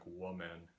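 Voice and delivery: plural as WUH-men, first vowel like the singular; unchanged plural vowel. Shown as a demonstration. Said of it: "seems very weird to me to say".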